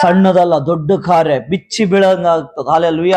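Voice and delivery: rousing sermon delivery, rising and driving; fervent preaching. Real, not acted. Pastor preaching to the congregation about God's gifts, fervent. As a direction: preach it to the believers with conviction.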